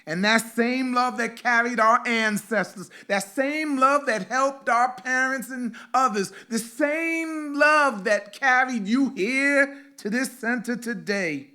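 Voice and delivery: loud, high, impassioned preaching; preaching at full force. Acted. Pastor preaching to the congregation about ancestors, loud and fervent. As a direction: lift the room with rising fervor.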